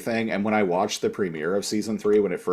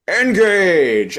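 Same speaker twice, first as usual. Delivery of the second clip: loud, high heroic command; commanding shout; 8 semitones higher, 7 dB louder than usual. Retold or imitated. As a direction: bark the order like a captain. Picard's climactic order retold.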